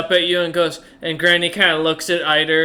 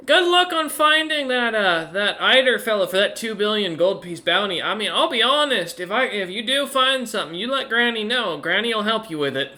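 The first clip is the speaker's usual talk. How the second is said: put-on higher, cheery old granny waving goodbye; 5 semitones higher than usual. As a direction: wave them off like a kind grandmother. GM voices Granny.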